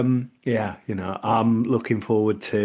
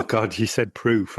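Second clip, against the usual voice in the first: ordinary delivery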